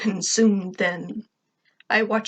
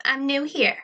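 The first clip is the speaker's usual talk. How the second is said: put-on high-pitched new girl; lightly voiced high girl character; high-accented girl; 5 semitones higher than usual. A narrator's reading. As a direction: introduce yourself in a put-on higher voice. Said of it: "said in a high accent".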